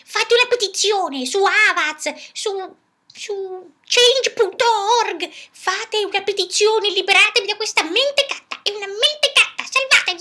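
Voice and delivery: high, loud indignant puppet voice; indignant diva rant. Acted. Puppet begs the viewers to save her, high and indignant. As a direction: rant like a mistreated star begging rescue.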